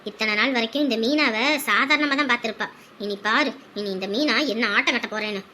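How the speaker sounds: low, quiet villainous plotting; scheming woman plots revenge quietly; menacing scheming. Acted. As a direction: vow revenge under her breath.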